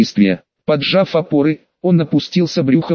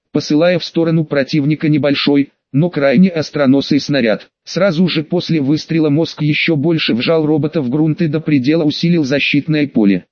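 plain reading voice

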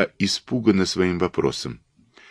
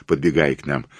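ordinary delivery

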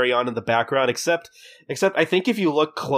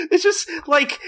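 talking through real laughter; giggling too hard to finish the sentence; laughing. Real, not acted. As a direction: crack up while getting the words out. Cracking up at the wizard joke.

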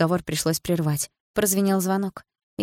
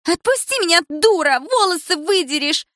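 high, loud yell, slowing at the end; pained shout; 11 semitones higher, 5 dB louder than usual. A narrator's reading. Milana yelling at Dilara who pulls her hair, pained. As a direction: scream at her to let go.

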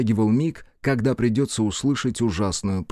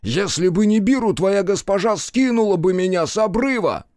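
angry retort; 8 semitones higher than usual. Acted. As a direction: hurl it at her. Yashiki's furious retort.

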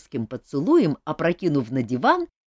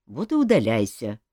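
grumbling; 7 semitones lower than usual. Acted. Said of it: "проворчал король".

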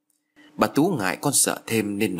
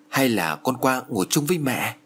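ordinary delivery, nothing marked

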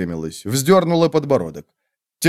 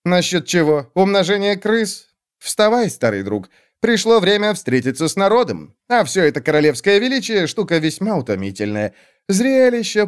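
lightly voiced, higher-pitched talkative king; a hint of a world-weary monarch, pitched up; king bored with pomp; 7 semitones higher than usual. King grumbles to old friend about royal pomp, lightly voiced weariness.

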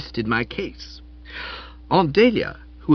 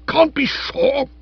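high, loud, slow indignant echo; bristling; 5 dB louder, slower than usual. Acted. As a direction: bristle like a wounded hornet. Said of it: "Spode bristled like a hornet".

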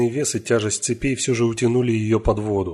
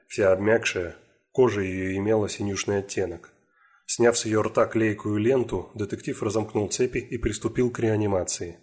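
plain reading voice

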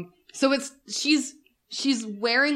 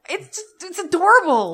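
gushing; 8 semitones higher, 6 dB louder than usual. Real, not acted. Co-host asked about the video, gushing. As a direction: start small and high, burst with adoration.

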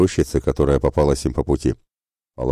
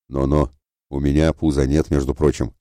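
usual speaking voice

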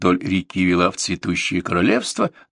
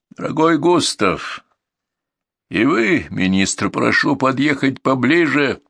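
higher, ringing royal summons; measured, imperious calling out; loud, commanding; 6 semitones higher than usual. A narrator's reading. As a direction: summon them with authority. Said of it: "раздался его громкий властный голос".

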